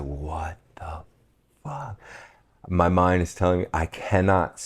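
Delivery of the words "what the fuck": soft, breathless what the fuck; whispered shock. Retold or imitated. Stunned by the talking iguana, a whispered curse. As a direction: barely voice the disbelief.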